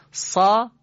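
سا with a thick emphatic ص; emphatic Sad. Shown as a demonstration. Teacher modeling the letter ص for students. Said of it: "اول صاد".

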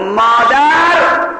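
long, drawn-out high calls; calling out in alarm. Acted. Girl calling her mother about the intruder, acted shout. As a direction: yell for mother, stretching each call. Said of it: "دختره داد زد".

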